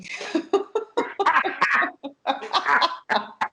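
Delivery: long burst of real laughter. Real, not acted. Mother cracking up at her son's answer, laughing.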